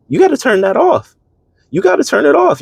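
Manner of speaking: rising fervent insistence; excited urging. Real, not acted. Urging listeners to cut the radio.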